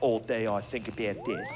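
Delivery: put-on low Australian-accented goth; lightly mocked Australian accent, brooding and low. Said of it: "You sound funny".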